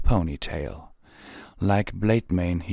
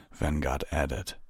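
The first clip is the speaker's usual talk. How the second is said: usual speaking voice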